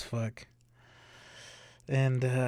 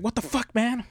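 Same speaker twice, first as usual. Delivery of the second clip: high yelp of outrage, voice jumping up; 10 semitones higher than usual. Retold or imitated. Biker sees his bike wrecked, shrieks a high angry yelp. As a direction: squeal it like you can't believe it.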